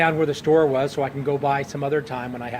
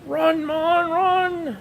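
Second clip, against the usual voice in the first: very high Scottish holler, louder; Sean Connery yell; 13 semitones higher, 5 dB louder, much slower than usual. Retold or imitated. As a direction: shriek him down the line with a Scottish burr. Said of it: "Sean's jumping up".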